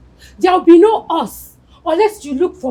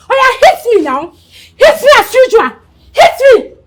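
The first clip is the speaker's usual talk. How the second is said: loud, higher dare; defiant taunting shout; 6 semitones higher, 7 dB louder than usual. Acted. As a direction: challenge him to strike, rising. Wife taunts husband to hit.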